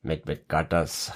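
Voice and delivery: low, soft Scottish advert line; quietly tried-on Scottish accent. Shown as a demonstration. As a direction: quote an old advert in a put-on Scottish accent. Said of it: "we can try a Scottish accent as well".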